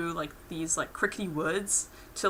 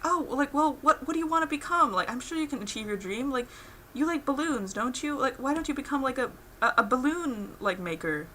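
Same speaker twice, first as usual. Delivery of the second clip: lightly voiced sweet Pim; 7 semitones higher than usual. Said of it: "Pim is like".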